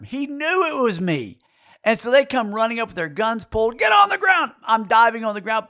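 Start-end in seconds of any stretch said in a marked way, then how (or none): 3.8-4.5 high-pitched shouted command; police shout. Retold or imitated. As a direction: yell like an arresting officer. Acting armed police shouting orders.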